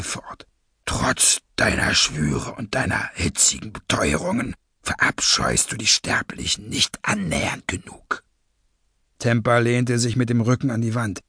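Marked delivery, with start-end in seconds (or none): 0.8-8.2 low, breathy villain voice; cold masked villain. Acted. Masked leader accuses a traitor, low and breathy.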